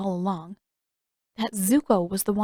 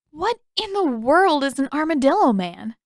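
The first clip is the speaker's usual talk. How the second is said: higher, louder baffled question; 7 semitones higher, 5 dB louder than usual. A narrator's reading. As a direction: ask in total disbelief. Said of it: "Katara asked".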